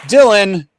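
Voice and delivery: big hyped announcer shout; announcer call. Acted. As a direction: call the name like a host. Announcing guest on live show.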